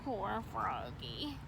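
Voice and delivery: pity. A narrator's reading.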